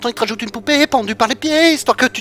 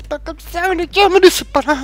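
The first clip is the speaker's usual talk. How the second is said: panicked pleading; 10 semitones higher, 6 dB louder than usual. Acted. Begging friends not to leave.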